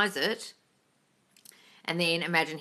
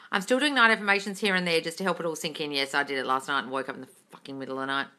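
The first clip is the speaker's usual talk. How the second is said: ordinary talk, nothing marked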